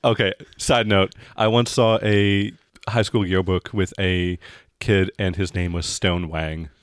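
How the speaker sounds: normal talking voice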